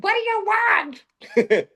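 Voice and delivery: high, slow, puzzled mother; mother's high puzzled reply. Retold or imitated. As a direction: ask it high and slow, baffled.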